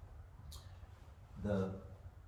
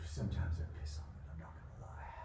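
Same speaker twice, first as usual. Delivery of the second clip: whispered aside; 5 semitones lower, 6 dB quieter than usual. Real, not acted. Confiding aside.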